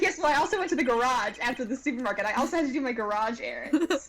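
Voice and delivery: rushed, lightly voiced witness; quick mock excuse. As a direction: rattle off the flimsy excuse. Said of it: "He's like".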